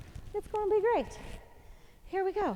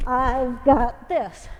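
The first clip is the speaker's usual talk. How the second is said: "I've got this" stretched slow, each word on a beat; slow rhythmic mantra; 7 dB louder, much slower than usual. Shown as a demonstration. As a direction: chant "I've got this" slowly in time with the reps. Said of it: "Gives you a little rhythm".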